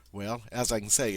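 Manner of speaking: ordinary talk, nothing marked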